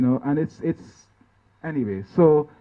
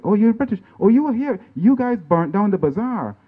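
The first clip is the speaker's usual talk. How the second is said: 明显提高音调的激动指责；像认出仇人般激动地喊出来; 高声激动地责问; excited accusation; 8 semitones higher, 3 dB louder than usual. Retold or imitated. Villager recognizes the British captain.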